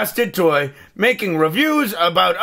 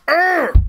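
short high strained grunt; effort grunt; 8 semitones higher than usual. Real, not acted. Straining to open the box.

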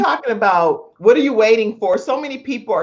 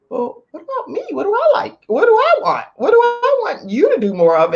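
mock inner voice; 13 semitones higher than usual. Voicing a wife's own needs.

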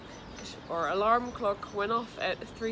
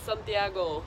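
put-on Spanish accent; 3 semitones lower than usual. Vlogger announcing the city they arrived in, in a Spanish accent. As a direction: place name said with a playful Spanish accent. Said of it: "I don't know why I did the Spanish accent".

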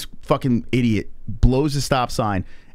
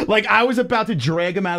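high giggle through the words; laughing; 10 semitones higher than usual. Real, not acted. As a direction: laugh it off sheepishly. Laughing at own rage.

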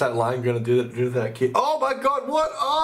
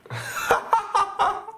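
burst of laughter. Real, not acted. Sudden burst of laughter at video.

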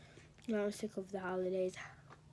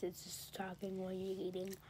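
whisper; 2 semitones lower, 9 dB quieter than usual. Acted. Mocking aside.

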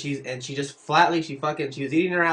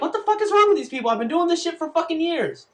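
baffled; 12 semitones higher than usual. Retold or imitated. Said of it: "I'm like".

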